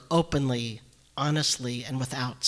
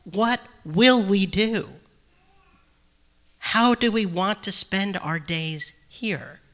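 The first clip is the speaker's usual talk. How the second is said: near exclaim; 5 semitones higher than usual. Retold or imitated. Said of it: "she seems to almost exclaim".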